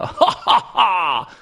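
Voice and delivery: high cackling mad-scientist laugh; evil scientist laugh. Pretend. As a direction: cackle like a villain plotting. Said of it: "That was my evil scientist laugh".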